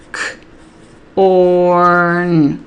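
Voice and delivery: CORN sounded out as a short K, pause, long held ORN; segmented sounds. Shown as a demonstration. Tutor modelling say and write corn, sounds segmented and stretched. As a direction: split "corn" into K ... ORRRN. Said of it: "They need to hear it segmented".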